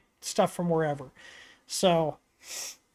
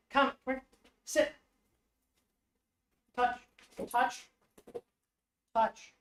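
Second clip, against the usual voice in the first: training two dogs for treats, much softer commands; quiet dog commands; 3 semitones higher, 6 dB quieter than usual. Real, not acted. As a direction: murmur the commands to the dogs close by.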